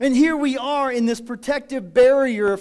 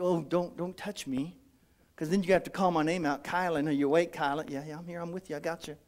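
soft teasing aside; 5 semitones lower, 7 dB quieter than usual. Real, not acted. Playful exchange with congregant.

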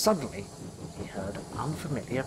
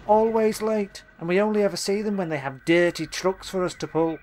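a proud engine's sulky, louder complaint; grumbling engine; 7 semitones higher, 5 dB louder than usual. A narrator's reading. James grumbling to himself about little engines, lightly voiced. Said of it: "James was still grumbling".

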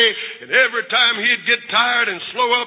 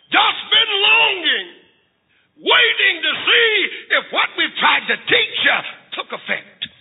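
fervent longing; 7 semitones higher than usual. Acted. Mother telling son how she waited, fervent. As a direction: call it out with preacher's fervor.

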